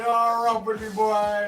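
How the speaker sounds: put-on loud, high Jamaican accent taunt. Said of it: "in his Jamaican accent".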